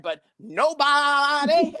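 high, loud, hugely stretched "nobody"; punchline delivery. Retold or imitated. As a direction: belt out the pun, dragging the word. Delivers pun punchline on song title.

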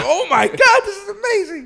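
very high, loud laughing gush; laughing delight. Retold or imitated. Recalling his theater reaction, squealing with laughter. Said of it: "I was like".

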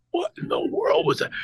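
whispered high gasp; mock shocked audience. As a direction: breathe it out in shock. Crowd's shocked reaction voiced.

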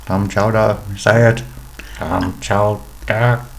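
slow, robotic Dalek grinding out Clam chowder. Pretend. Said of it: "Steve the Dalek to say".

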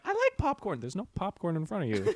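high mock actor voice. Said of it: "But he's like".

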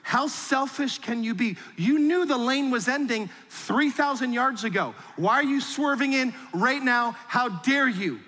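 a mock furious commuter, high and pushed; mock road rage. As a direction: play the outraged driver yelling at a car. Mock angry driver rant.

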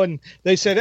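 ordinary talk, nothing marked

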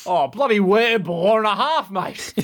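put-on comic Australian accent; mock Australian. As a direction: bellow an Aussie oath. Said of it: "Australian faux curse word".

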